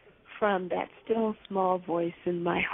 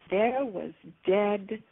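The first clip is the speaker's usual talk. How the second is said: usual speaking voice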